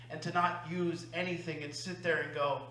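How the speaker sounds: normal talking voice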